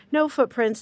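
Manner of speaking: ordinary talk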